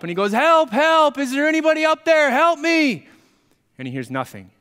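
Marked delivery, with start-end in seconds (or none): 0.3-3.1 high, loud cries for rescue; yelling for help. Retold or imitated. Fallen climber calls up for anyone, yelling for help. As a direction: shout up the cliff in panic. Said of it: "he starts yelling for help".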